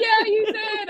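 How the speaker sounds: high, laughing squeal; retold celebratory squeal; imitated cheering. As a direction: cheer someone on in a giddy high voice. Everyone cheering her on.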